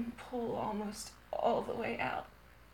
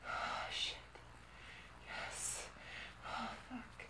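very soft, voiceless exclamations; hushed, intimate whisper; 13 dB quieter, slower than usual. Real, not acted. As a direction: let the words out on breath. Intimate, breath only.

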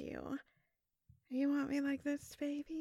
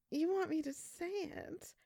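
ordinary delivery

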